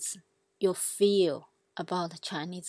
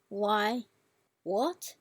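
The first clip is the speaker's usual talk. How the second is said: "why", "what" as rising second tones. Shown as a demonstration. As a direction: lift "why" and "what" like questions. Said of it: "all the four tones".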